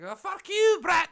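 put-on fiery Scottish girlfriend. Imagined Scottish girlfriend telling off brother Brett, high, loud and furious. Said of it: "a crazy accent".

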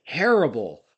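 loud, punched "terrible," in a jowly growl. Retold or imitated. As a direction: belt out "terrible," heavy-jawed.

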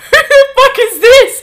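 screeching laughing disbelief; shocked laughing shriek. Retold or imitated. Discovering wrong Instagram.

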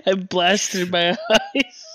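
laughing. Real, not acted. Laughing at own mishap.

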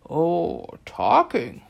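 loud sarcastic bellow. A narrator's reading. Air god mocks Anubis with a loud bellow. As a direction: bark the word talking, loud. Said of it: "Shu bellowed".

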